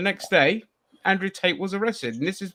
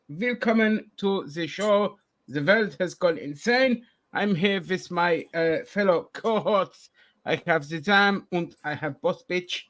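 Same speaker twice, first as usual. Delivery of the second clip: mock German accent; 4 dB louder than usual. Hammed-up German accent opening the podcast show. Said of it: "this German accent is doing my nothing".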